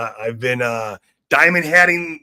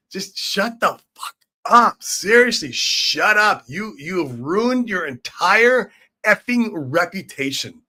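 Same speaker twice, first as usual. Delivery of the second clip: exasperated telling off; 5 semitones higher, slower than usual. Real, not acted. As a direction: bark it at them, fed up. Telling critics to shut up.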